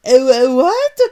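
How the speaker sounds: high, loud baffled Italian; Mario baffled by accusation, loud confused. Acted.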